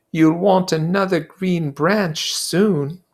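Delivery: high, earnest schoolboy voice, slowing to insist; a fussy boy pleading for fairness; Piggy's voice. Acted. Piggy's line.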